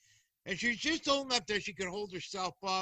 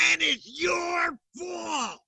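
much higher, slow, shouted blame; accusing yell; 10 semitones higher, slower than usual. Retold or imitated. [name] mimicking Monica shouting blame at him across the counter. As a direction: yell it while pointing him out. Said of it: "on top of her mouth, lungs".

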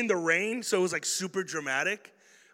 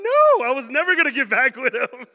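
ordinary talk

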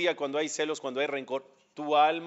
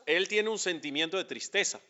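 ordinary delivery

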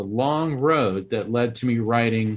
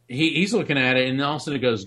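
ordinary talk

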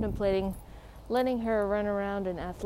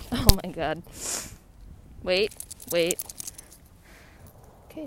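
usual speaking voice

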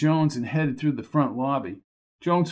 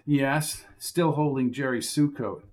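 ordinary talk, nothing marked

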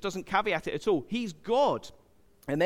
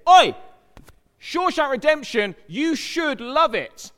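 loud high heckling Oi, slow on the last words; mock shout; 9 semitones higher, 9 dB louder than usual. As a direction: yell it at him like a pushy crowd. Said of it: "loads of people said".

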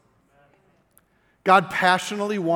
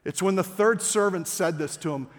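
ordinary talk

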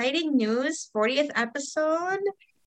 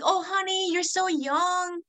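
mock-sweet raised voice, stretched out; mimicking older callers; 7 semitones higher than usual.

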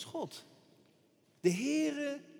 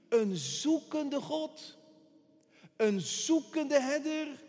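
very slow, higher, stretching out zoekende; tender call of a seeking shepherd; slow emphasis; 6 semitones higher, 4 dB louder, much slower than usual. Real, not acted. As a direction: lean hard on zoekende.

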